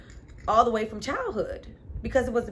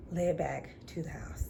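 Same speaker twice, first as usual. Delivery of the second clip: softer whispered aside; 5 semitones lower, 8 dB quieter than usual. Real, not acted. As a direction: whisper it like a secret. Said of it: "whispered aside".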